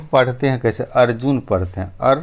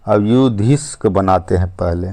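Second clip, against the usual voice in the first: normal talking voice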